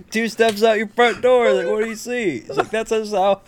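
very high put-on voice; mock eighties reminiscence. Joking to friends about the eighties, high mock exclaiming. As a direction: pitch it way up, hamming it up.

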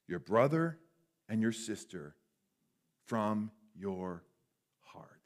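slow, weighty reading with long pauses; solemn scripture reading. A narrator's reading. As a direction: let each phrase land, fading to a hush.

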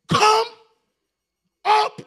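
very high, loud commanding call; God's summons ringing out; booming call. Acted. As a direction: call him up with full force. God calling John upward.

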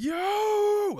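very high, drawn-out excited "yo"; losing your mind. Acted. Person spotting their doppelganger, hammed-up losing it. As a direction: squeal it in hammed-up amazement. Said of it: "you're gonna lose your mind".

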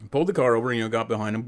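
ordinary talk

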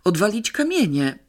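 put-on boy catching on, excited; excited exclamation. A narrator's reading. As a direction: blurt it out as the idea hits. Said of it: "krzyknął Pawełek".

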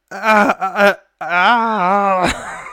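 loud high broken crying groans; exhausted crying wail. Pretend. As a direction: wail like a wrecked runner on the phone. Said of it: "it's just him going".

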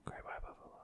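breathy whispered echo; hushed ASMR whisper. Pretend. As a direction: whisper it like a secret.

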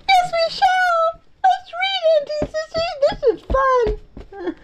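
very high, squeaky little voice; squeaky character voice. Pretend. Little one begs Auntie to keep reading, high squeak. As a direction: squeak it eagerly like a tiny pet. Voicing pet answering the reader.

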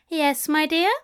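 high, sweetly innocent question; innocent angel. A narrator's reading. Angel playing innocent after outburst.

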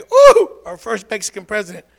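hammed-up giddy fan; mock gushing. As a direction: lampoon the cheering crowd. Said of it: "a lot of Mexicans were all happy".

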